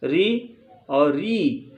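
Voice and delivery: री said short, then री held long. Shown as a demonstration. Teacher to class, contrasting short and long री. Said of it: "दोनों को उच्चारण करने में अलग-अलग अंतर है".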